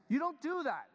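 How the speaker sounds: emphatic exclamation. Real, not acted. Pastor stressing manners to congregation, emphatic. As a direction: drive the point home. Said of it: "Why am I talking like this".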